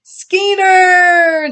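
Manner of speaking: high, loud happy shout of a name; cried out. A narrator's reading. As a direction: call her name with delight. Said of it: "the pigs cried out".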